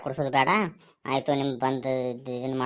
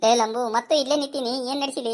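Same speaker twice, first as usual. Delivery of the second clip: mocking call; 9 semitones higher, 3 dB louder than usual. Teasing friend calls Lambu.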